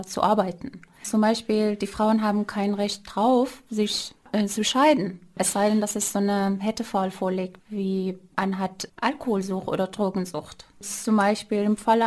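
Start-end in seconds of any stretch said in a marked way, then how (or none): none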